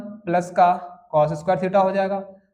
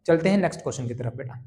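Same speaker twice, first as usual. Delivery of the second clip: ordinary delivery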